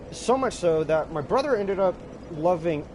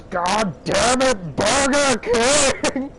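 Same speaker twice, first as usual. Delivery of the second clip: slow, loud, drawn-out exasperated yell; mock angry outburst; 5 semitones higher, 5 dB louder, much slower than usual. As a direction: curse the nickname like a fist-shaking brother. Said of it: "shakes his fist, and goes".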